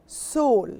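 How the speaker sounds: Seoul as a single syllable, "sohl"; English pronunciation. Shown as a demonstration. Teacher repeating for learners, Seoul as "sohl". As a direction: model Seoul like the word "soul". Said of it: "in English we say Seoul".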